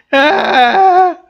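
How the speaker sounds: sudden high, loud "Ah"; startled exclamation; shocked gasp. Real, not acted. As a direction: caught off guard by the scene, blurt it out. Shocked reaction to scene.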